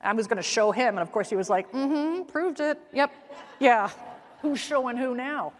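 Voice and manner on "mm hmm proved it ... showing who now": higher, teasing gloat of the husband; lightly voiced gloating husband; smug husband. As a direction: needle her for proving his point.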